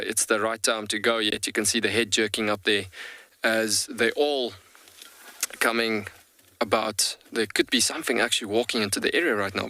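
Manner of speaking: usual speaking voice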